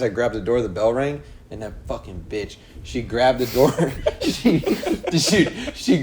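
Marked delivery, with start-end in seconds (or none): 3.5-6.0 breaking up laughing mid-sentence. Real, not acted. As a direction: try to get the story out through laughter. Cracking up while telling story.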